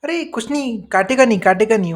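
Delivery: high, loud, breezy dismissive owner; mock a dog owner waving off worry; dog owner imitation. Teacher imitating a dog owner insisting the dog won't bite, high and loud. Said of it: "जो कुत्तों के मालिक नहीं होते हैं".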